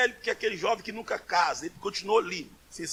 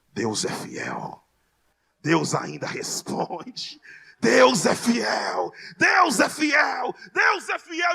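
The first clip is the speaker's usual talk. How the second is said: building to shouted praise; 4 dB louder, much slower than usual. Retold or imitated. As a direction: chant it slowly, rising from a murmur to a shout. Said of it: "começa a gritar". (clamor lento que cresce de murmúrio a grito)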